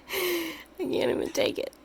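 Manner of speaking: slow, cooing over a tiny gecko. Real, not acted. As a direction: melt over his little nose.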